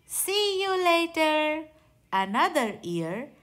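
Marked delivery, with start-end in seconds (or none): 0.1-1.7 high, sing-song farewell call; a bright, lilting see-you-later; cheerful goodbye. Acted. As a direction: sing it out like a happy gator waving goodbye. Gators saying goodbye, leaving.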